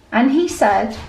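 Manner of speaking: plain reading voice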